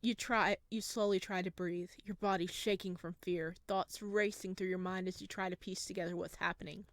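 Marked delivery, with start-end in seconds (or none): none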